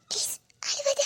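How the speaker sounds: breathy tearful plea, almost voiceless; tearful whisper. Acted. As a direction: beg him through tears in a whisper. Wife begging him not to cry. (அழுகையுடன் கிசுகிசுப்பு)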